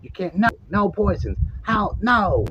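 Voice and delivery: high squeaky voice of angry little gnomes. Retold or imitated. Gnomes telling the man to get out, high squeaky gnome voice. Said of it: "They're like".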